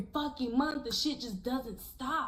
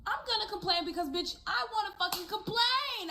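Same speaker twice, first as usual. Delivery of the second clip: whiny complaint; 11 semitones higher than usual. Acted. Mock whiny complaining intro.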